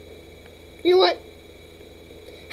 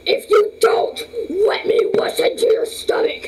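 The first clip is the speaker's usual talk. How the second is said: squeaky pet threat; 10 semitones higher, 3 dB louder, slower than usual. Pretend.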